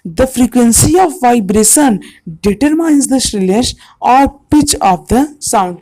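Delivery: very slow, deliberate definition; slow dictation. Shown as a demonstration. Teacher dictating a definition to students, very slowly. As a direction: spell it out slowly for notes. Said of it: "बहुत ही ध्यान सुनिएगा".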